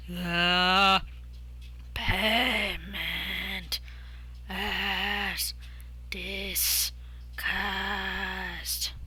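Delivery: fading halting rasp, cut off; rasping broken voice. Acted. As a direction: rasp each word then cut. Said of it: "It rasped every sound, cut out suddenly".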